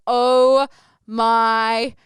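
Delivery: panicked realization. Retold or imitated. Realizing her mistake onstage.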